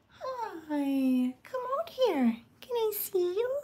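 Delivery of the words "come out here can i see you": high, sing-song call to the cat; high cooing call. Real, not acted. Woman coaxing her cat Rip in a high baby voice.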